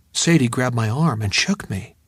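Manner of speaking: ordinary delivery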